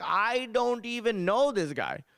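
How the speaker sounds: high, slow mock denial; drawn-out Indian-accented disowning; scared dad. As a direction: play a cowed dad pleading ignorance. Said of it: "he's scared of my mom".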